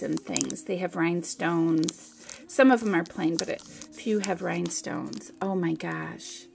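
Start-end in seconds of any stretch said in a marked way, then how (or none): none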